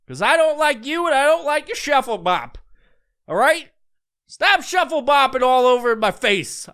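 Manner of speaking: a riled-up complaint, pushing hard; angry rant. Real, not acted. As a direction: tell them off, worked up. Ranting at TikTok dancers.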